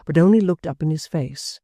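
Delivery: ordinary delivery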